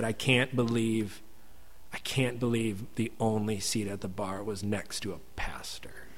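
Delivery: choked, breathless whisper through tears; tearful whisper. Retold or imitated. The woman at the bar, moved to tears, whispering to the pastor. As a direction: let it fall to a broken whisper. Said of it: "she's crying, I'm crying".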